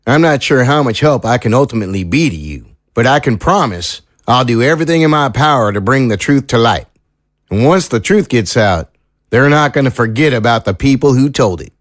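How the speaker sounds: country lawman making a sober promise; folksy small-town sheriff, earnest. Acted. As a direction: play a sheriff pledging help without overpromising. Sheriff figure makes a promise.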